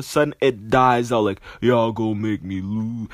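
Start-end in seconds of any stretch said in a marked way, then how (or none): 1.6-3.1 the rap line fading like a dying speaker; stereo dying out. Retold or imitated. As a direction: let it sag as the stereo cuts out. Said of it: "His stereo system dies out".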